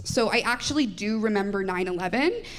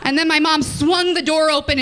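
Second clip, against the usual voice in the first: ordinary talk, nothing marked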